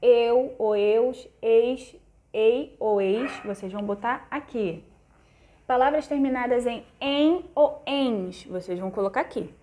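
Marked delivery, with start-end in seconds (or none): 0.0-3.3 eu, eus, eis, ei said very slowly and deliberately; teacher slowly sounding out the endings. Shown as a demonstration. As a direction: spell each ending out slowly for pupils. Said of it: "Todas as palavras terminadas em oi ou os".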